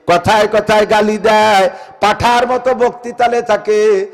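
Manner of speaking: high, loud, drawn-out sermon cry; ringing preacher's chant; full-force preaching. Acted. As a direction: call it out to the whole mosque.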